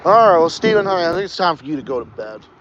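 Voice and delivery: ordinary delivery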